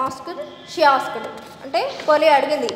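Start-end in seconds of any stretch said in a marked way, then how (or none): none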